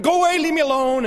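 high, loud, irritated brush-off; angry. Acted. Angry passer-by to a beggar asking for help, irritated high outburst. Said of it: "we're angry and we're like".

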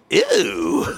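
mock grossed-out ew; disgusted ew. Preacher mimics the crowd's disgust, mock ew. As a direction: pull a face and go ew. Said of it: "That's what they said".

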